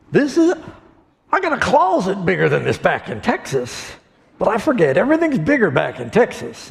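high, brash bragging voice; a rich rancher crowing; boastful Texan. Retold or imitated. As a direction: rancher bragging about his closet. Texan boasting about closet.

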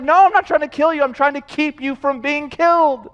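high, loud, urgent explanation; exasperated God. Acted. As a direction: insist forcefully like a parent saving a child. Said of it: "God's like".

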